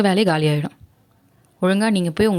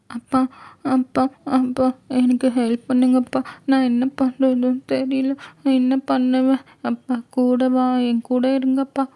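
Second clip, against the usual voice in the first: put-on high, slow, tearful young girl's pleading; crying girl; 6 semitones higher, slower than usual. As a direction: sob out a plea for her father, high and slow. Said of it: "யாரோ அழுகுற sound". (அழுதுகொண்டே அப்பாவை கெஞ்சும் சிறுமி குரல்)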